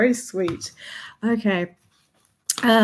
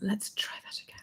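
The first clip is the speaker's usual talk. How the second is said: whispering; 15 dB quieter than usual. Acted. Back to ASMR whisper.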